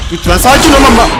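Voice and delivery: high, loud startled begging; panicked plea. Real, not acted. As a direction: shout a frantic plea. Panicking as enemy appears.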